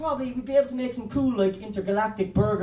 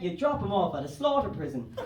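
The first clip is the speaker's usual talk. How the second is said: normal talking voice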